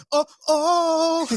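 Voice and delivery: mock sex moan. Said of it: "fake they're having sex".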